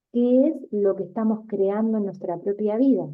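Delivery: usual speaking voice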